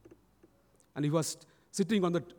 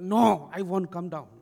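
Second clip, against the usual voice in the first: slow, louder stubborn no; adamant refusal; 6 dB louder than usual. Retold or imitated. Boy refusing his pleading siblings, adamant. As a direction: stretch out a firm refusal. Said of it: "He was so adamant".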